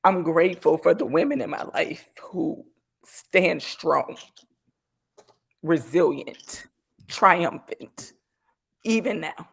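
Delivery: choked up, halting between phrases; real teary-eyed. Real, not acted. Thanking the strong women in her life, teary-eyed. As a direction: fight back real tears as you speak. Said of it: "I'm about to get teary-eyed".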